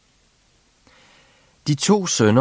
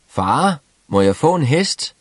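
calling out; 6 semitones higher, 3 dB louder than usual. A narrator's reading. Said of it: "råbte Klods Hans".

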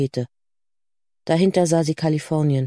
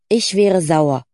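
emphatic, falling "Ich wäre sauer"; firm insistence; 4 dB louder, slower than usual. Acted. Cora insisting to doubtful Lucy, firm.

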